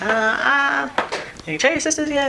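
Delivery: high-pitched, hesitant mom question; retold cautious asking; imitating mom. Mom's retold careful question.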